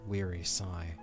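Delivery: usual speaking voice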